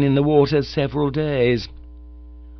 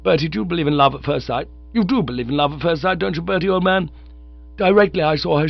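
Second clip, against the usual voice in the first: higher, rushing rapture; lovestruck young chap; 6 semitones higher than usual. Acted. Bingo gushes to Bertie about love, eager. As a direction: gush about the girl.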